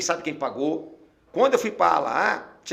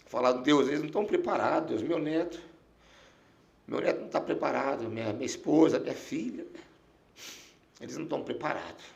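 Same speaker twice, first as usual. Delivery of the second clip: soft, low, halting real grief; choked with emotion; 4 semitones lower, 5 dB quieter than usual. Real, not acted. Dying man pleading to God for his family, choked up. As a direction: hold back tears while pleading quietly. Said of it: "até me emociona".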